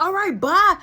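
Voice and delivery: high shouted bye; loud dismissive bye; loud mock yell. [name] yelling on porch.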